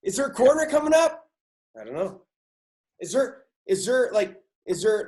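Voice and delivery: high, loud frantic questions; acted panic in the dark. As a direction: fire off questions as if blind at the wheel. Acting the driver's panic in darkness.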